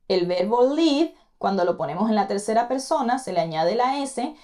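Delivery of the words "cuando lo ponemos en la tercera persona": usual speaking voice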